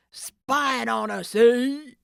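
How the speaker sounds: slow loud menacing snarl. Acted. The thief catches Nancy, snarling slowly. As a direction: drag the words out as a threat. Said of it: "he snarled".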